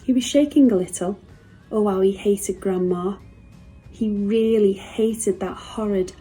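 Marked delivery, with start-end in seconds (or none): none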